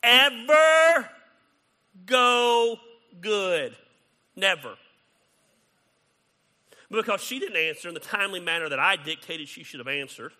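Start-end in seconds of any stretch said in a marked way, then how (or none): 0.0-4.8 "Ever" much higher and louder, words drawn apart; emphatic stress. Shown as a demonstration. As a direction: hammer "Ever go good", then "Never" punched. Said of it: "that does never go good".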